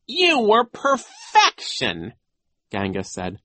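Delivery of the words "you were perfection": high, loud, very slow praise; gushing flattery. A narrator's reading. Genghis praises Nero's concert, gushing slowly. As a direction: draw out every word of praise.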